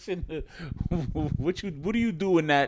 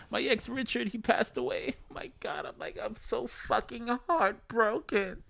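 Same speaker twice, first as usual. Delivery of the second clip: a lightly hammed grieving girlfriend; mock heartbroken girlfriend; 7 semitones higher than usual. A girlfriend mourning her ex to her man, higher.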